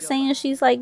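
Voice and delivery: usual speaking voice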